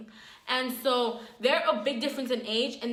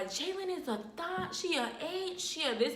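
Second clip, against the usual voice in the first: auntie insulting; 6 semitones higher, 5 dB quieter than usual. Retold or imitated.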